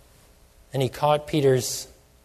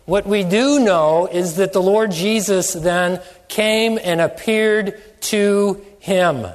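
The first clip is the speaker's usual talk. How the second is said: loud, slow rising sermon climax; fervent preacher, loud and drawn out; preaching at full force; 3 semitones higher, 4 dB louder, slower than usual. Acted. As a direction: proclaim it to the congregation.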